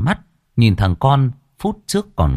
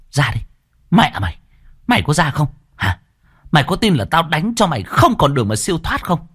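threatening, pitched up a little; furious hiss; 7 semitones higher than usual. Acted. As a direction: warn him she'll beat him. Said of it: "Chị Hương lại rít lên".

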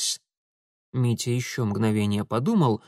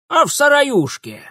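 high, loud granny reply; old woman calling out; 13 semitones higher, 7 dB louder than usual. Acted. Old woman tells Mitya where oars are, high holler.

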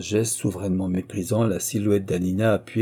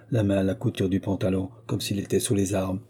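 plain reading voice

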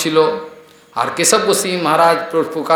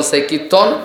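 ordinary talk, nothing marked

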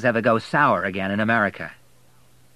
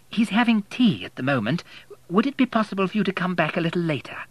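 much higher, quicker genteel lady; fussy plump lady, fast and polite; vicar's wife; 9 semitones higher than usual. A narrator's reading. Vicar's wife putting off the visitor, fast and high.

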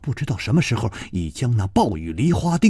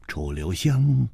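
usual speaking voice

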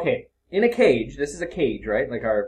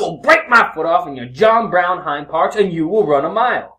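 Denzel impression; 4 semitones higher, 6 dB louder than usual. Trading Denzel movie line.

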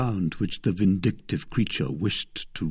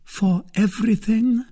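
high lady's voice, rising question; 14 semitones higher, 4 dB louder than usual. A narrator's reading. Lady testing her suitor's readiness, high and questioning. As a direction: check the pledge in a high, searching voice. Milady questions his pledge.

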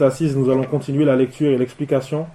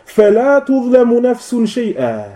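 higher, slow, drawn-out verse recitation; Quranic recitation; 6 semitones higher, slower than usual. Real, not acted. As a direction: recite the verse slowly, voice raised in pitch. Citation récitée d'un verset coranique. (récitation coranique lente)

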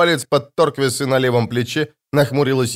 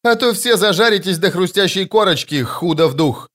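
raised, scolding corporal's voice; barked threat; 6 semitones higher than usual. A narrator's reading. Corporal threatening the rowdy squad, barking. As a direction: warn them all crossly, pitched up. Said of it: "рявкнула она".

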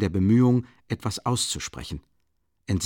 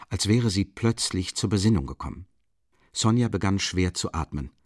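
ordinary delivery

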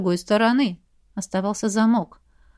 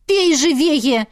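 loud urgent command; shouted hurry; 5 semitones higher, 4 dB louder, slower than usual. Acted. Alina shouts at the prince to drink faster. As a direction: hurry him up sharply. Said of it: "крикнула Алина".

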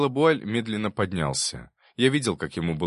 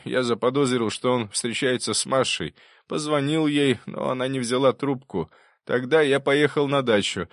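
lightly voiced professor, higher-pitched confession; professor testifying; 7 semitones higher than usual. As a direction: recount it to the court in a raised pitch. (профессор даёт показания, голос чуть приподнят)

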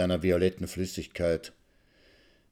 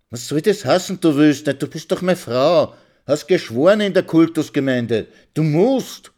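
loud and higher, insisting; angry demand; 7 semitones higher, 7 dB louder than usual. Acted. Husband yelling that his wife must obey, loud and angry. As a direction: shout him down as her husband. Husband shouting back in quarrel.

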